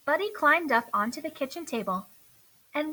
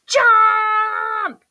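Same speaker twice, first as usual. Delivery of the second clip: much higher, much louder roar; 10 semitones higher, 12 dB louder than usual. Acted. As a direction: bellow the command. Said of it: "roared Earl".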